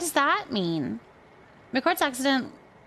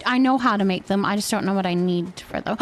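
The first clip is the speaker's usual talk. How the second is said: ordinary talk, nothing marked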